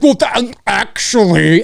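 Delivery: sneering pedant, drawn out; mocking know-it-all. Imitating a know-it-all commenter correcting him. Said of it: "are like".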